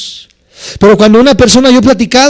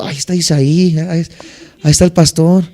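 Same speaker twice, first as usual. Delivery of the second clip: son's hushed tattling; 4 semitones lower, 3 dB quieter than usual. Retold or imitated. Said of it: "como diciendo".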